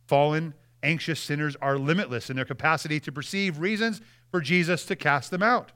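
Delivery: ordinary delivery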